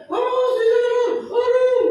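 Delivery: high, slow drawn-out panicked cry; panicked wailing. Retold or imitated. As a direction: wail it like someone caught in a landslide. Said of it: "teriak-teriak". (ratapan panik yang tinggi dan lambat)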